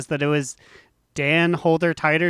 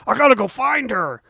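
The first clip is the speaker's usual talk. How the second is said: eager exclaim; 8 semitones higher, 5 dB louder than usual. Retold or imitated. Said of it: "And he's like".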